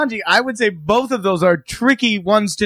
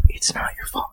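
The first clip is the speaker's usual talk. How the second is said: whispered, barely voiced; 9 dB quieter than usual. Whispering the movie line to a buddy, hushed. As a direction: breathe it out as a hushed secret.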